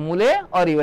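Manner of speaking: ordinary delivery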